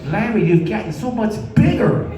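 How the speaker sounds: a woman gushing, building to a laughing shout; impressed woman. Retold or imitated. Woman praises Larry, laughing and building to a shout.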